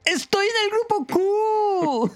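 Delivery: very high, slow, squealing disbelief; ecstatic Buddy. Retold or imitated. Outsider overjoyed at his acceptance, very high squeal. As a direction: shriek with joy on your knees. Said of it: "Buddy emocionado cae de rodillas".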